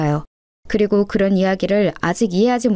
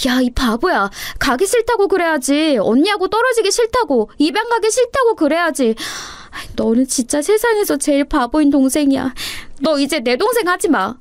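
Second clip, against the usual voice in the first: higher-pitched, exasperated big-sister scolding; upset scolding older sister; 7 semitones higher than usual. Acted. Older sister upset that her little sister agreed to leave, scolding. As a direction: scold the child out of hurt love.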